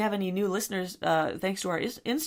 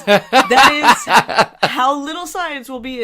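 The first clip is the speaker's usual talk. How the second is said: slow, laughing through the words; 6 semitones higher, 9 dB louder, much slower than usual. Real, not acted. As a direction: giggle out each word slowly. Laughing admission.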